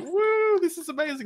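high, squealing mock excitement; mock cheering. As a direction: play the thrilled fans, way up high. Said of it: "everyone's like".

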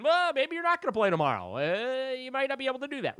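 high, rushed impression of the coach breaking the news; imitating the coach. Coach's verdict retold in voice.

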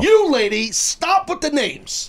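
high, drawn-out scolding shout; exasperated yell. Real, not acted. Host ordering co-host off the names, exasperated yell. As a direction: yell it as an order, dragging the words.

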